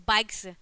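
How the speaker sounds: "बाइक्स" ending in a voiceless s; final s sound. Shown as a demonstration. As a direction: close "बाइक्स" with a clear s. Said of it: "स हो जाता है". ("बाइक्स" के अंत में स बोलें)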